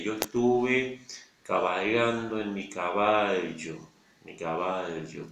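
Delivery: yo estuve cabalgando en mi caballo, slow, ll fully held; slow demo with the ll stressed; slow careful ll. Shown as a demonstration. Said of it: "Si dijéramos lentamente". (despacio, caballo con la ll marcada)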